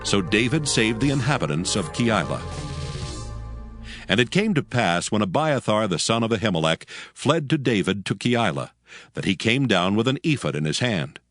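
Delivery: usual speaking voice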